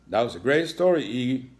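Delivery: beaming with pride; proud praise. Acted. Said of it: "with a proud smile".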